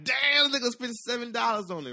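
high, loud astonished yell; amazed shout. Retold or imitated. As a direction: blurt it out stunned. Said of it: "I'm like".

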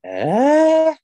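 high drawn-out disbelieving えー; exaggerated shocked disbelief; surprised. Real, not acted. Surprised reaction.

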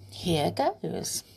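usual speaking voice

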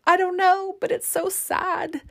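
a hint of a weepy new mom; retold crying reply. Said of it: "I was crying".

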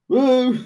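high mimicked woo; imitated whoop. Mocking the sudden switch from announcer voice, high imitated woo. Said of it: "announcer voice to".

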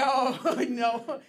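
loud, laughing mock "oh no"; laughing refusal. Pastor imagining fleeing God's call, laughing loudly. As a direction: laugh it out like a reluctant prophet.

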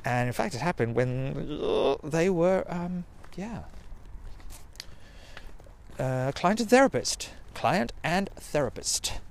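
ordinary delivery

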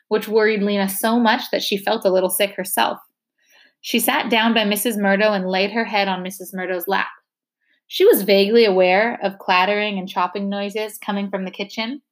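ordinary delivery, nothing marked

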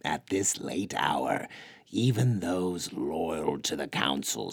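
sad. Acted. Curly Bigfoot telling Luke no one will come, sadly. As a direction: let the hopelessness weigh each word. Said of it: "Egelith answered sadly".

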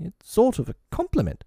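very high, piping bosun voice; Smee. A narrator's reading. Smee soothes Hook about the crocodile, piping. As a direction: pipe up high and mild.

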